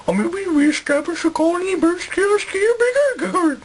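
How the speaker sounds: high, odd comic colonist; put-on interviewee voice. Colonist makes excuses to interviewer, high comic voice.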